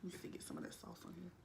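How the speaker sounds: under the breath, fast; whispering. Real, not acted. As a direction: whisper it to yourself while busy. Aside while saucing food.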